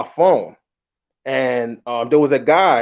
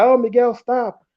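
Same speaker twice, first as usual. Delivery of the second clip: put-on giggly girlfriend; 10 semitones higher than usual. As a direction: imitate her playful giggling protest. Girlfriend giggling with Miguel on phone.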